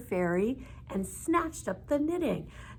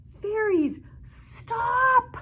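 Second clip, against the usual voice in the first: very high, loud shout; 7 dB louder, much slower than usual. A narrator's reading. Old woman shouting at the fairies to stop. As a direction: cry out to make them stop. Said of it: "she yelled".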